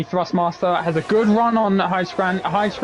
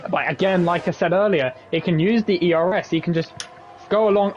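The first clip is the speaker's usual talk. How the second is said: normal talking voice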